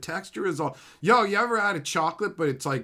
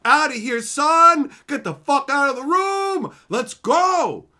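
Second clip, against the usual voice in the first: put-on louder, higher shout of a scared kid fleeing; mock panicked yell; 8 semitones higher, 8 dB louder, slower than usual. Recalling fleeing the TV as a kid, loud mock yell. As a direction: yell it like you're bolting from the room. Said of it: "and I'm like".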